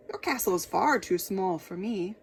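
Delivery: a touch of big dragon voice; lightly voiced dragon. Dragon replying to the king, lightly voiced dragon. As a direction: answer like a large beast.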